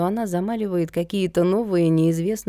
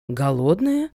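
incredulous steep rising question; surprised; 2 semitones lower, slower than usual. A narrator's reading. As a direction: echo it in astonishment. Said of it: "удивилась Ольга Викторовна".

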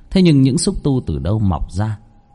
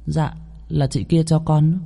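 put-on higher, slow, soft little boy; lightly voiced child; 6 semitones higher, 4 dB quieter, slower than usual. Son tells father where the toy came from. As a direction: answer innocently like a small boy. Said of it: "Thằng bé nói lanh lảnh".

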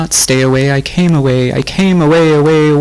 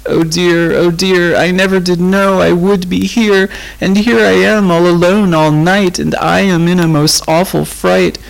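lonely frightened lament; wailing; 7 semitones higher than usual. A narrator's reading. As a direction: plead in a trembling wail. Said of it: "wailed Rose".

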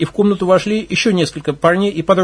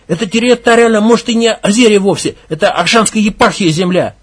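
loud, raised furious pronouncement; leader's furious final word; angry verdict; 3 semitones higher, 4 dB louder than usual. Acted. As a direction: declare it final. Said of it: "подвёл итог".

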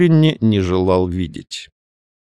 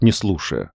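ordinary delivery

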